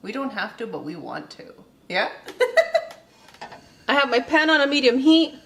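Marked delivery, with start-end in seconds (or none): 2.2-2.9 high giggle. Real, not acted. Giggling on camera.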